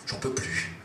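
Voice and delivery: breathless whisper, overcome; hushed, can't take it. Acted. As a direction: let it slip out on a breath. Overwhelmed desire.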